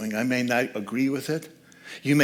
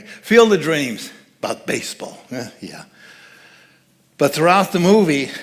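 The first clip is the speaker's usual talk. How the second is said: ordinary talk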